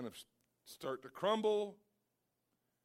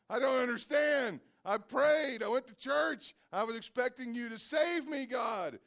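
high, loud, aggrieved complaint; indignant shout; 8 semitones higher, 7 dB louder than usual. Acted. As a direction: protest to God at the gates. Man complains at heaven's gates.

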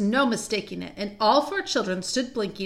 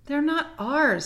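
higher-pitched, hesitant objection; doubtful; 6 semitones higher, slower than usual. Acted. Peter objects that the coats aren't theirs, doubtful. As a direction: voice a mild doubt. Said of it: "said Peter doubtfully".

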